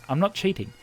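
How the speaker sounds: normal talking voice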